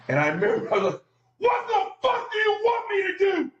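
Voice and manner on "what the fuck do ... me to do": high, loud furious yell. Retold or imitated. Player yelling back at his coach, furious. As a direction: scream it back in his face. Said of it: "I yelled it so loud".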